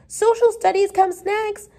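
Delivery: high, eager schoolgirl voice; high-pitched, excited pupil; eager cry. A narrator's reading. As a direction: pipe up keenly with the answer. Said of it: "cried Elizabeth Gardner eagerly".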